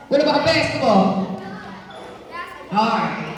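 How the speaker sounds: usual speaking voice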